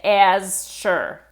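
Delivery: slow "as sure", two separate words; slow, split apart. Shown as a demonstration. Teacher shows the learner the words spoken slowly and apart. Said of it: "say these two words together slowly".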